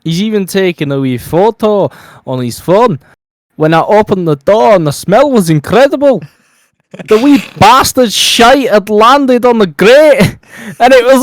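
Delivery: loud, high-pitched Scottish accent attempt; hammed-up Scottish, swooping high on each phrase. Friend has a go at reading the complaint in a loud mock Scottish accent. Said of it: "my Scottish accent isn't the best".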